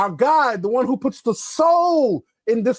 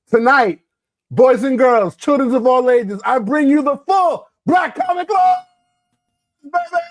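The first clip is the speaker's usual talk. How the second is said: hype announcer; 4 semitones higher, 4 dB louder than usual. Acted. Hyping the show's lineup.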